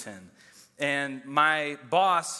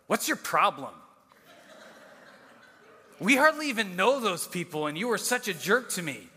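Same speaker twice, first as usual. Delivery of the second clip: imitating an exasperated wife, raised pitch; mock telling-off, louder and higher at the peak; indignant scolding; 5 semitones higher, 3 dB louder than usual. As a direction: act out an annoyed spouse's reproach. Wife scolds husband after game.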